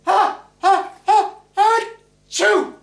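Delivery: high, loud hammed-up sneeze; high mock sneeze. Bride sneezing at the wedding, high loud mock sneeze. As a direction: build the ha's up high, then sneeze loud. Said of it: "and the bride went".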